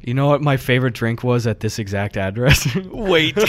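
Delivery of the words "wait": normal talking voice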